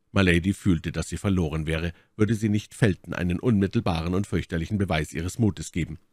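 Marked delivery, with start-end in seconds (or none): none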